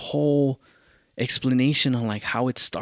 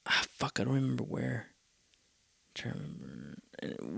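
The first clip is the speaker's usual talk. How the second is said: muttered to self; 11 dB quieter than usual. Real, not acted. Grumbles to himself, forgetting.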